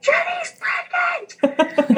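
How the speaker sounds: very high, drawn-out squealed announcement; imitated scream. Manager announcing coworker's pregnancy down the hall, imitated scream. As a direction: scream the news down the hall, stretching it out. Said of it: "she just screams".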